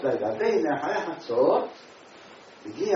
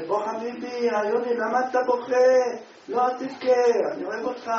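gentle comfort; 8 semitones higher, 5 dB louder than usual. Retold or imitated. Kind man comforts weeping stranger.

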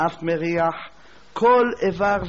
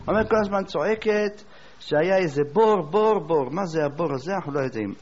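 usual speaking voice

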